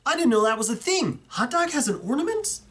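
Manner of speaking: can't believe this exists; finding out something he never knew about; real surprise. Real, not acted. Discovering the hot dog ornament.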